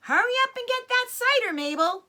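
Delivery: high, loud, fast woman's call from above; woman upstairs hurrying the maid along. Acted. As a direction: shout down to Mabel, impatient. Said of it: "the voice called".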